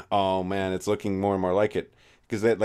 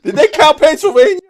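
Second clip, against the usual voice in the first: loud, high shrieking question; hammed-up outraged shout; mock indignant yell; 13 dB louder than usual. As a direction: shout it like a sore loser.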